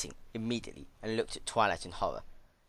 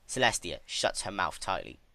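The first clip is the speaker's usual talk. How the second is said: usual speaking voice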